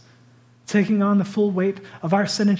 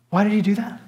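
usual speaking voice

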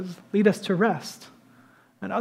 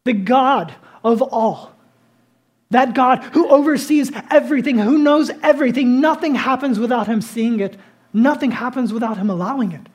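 higher and louder, declaring God's greatness; fervent preaching; 4 semitones higher, 5 dB louder than usual. Acted. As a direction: proclaim it with rising force.

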